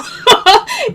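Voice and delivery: a quick bright laugh; high laugh. Real, not acted. Host laughs joking she'll go to California, bright laugh. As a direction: laugh at your own joke.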